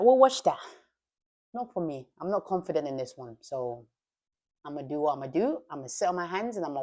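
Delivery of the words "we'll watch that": raised emphatic repeat; louder, higher repeat. Acted.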